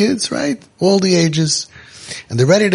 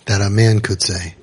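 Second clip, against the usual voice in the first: ordinary talk, nothing marked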